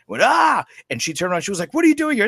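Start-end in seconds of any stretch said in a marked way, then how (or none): 0.2-0.7 high, loud yelp of fright; re-enacted shocked shriek, pitched way up; retold startled shout. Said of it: "and I shouted". 1.7-2.3 high, loud mock-scolding mom; loud, high-pitched retold outburst; startled mother. Startled mother catches hiding child.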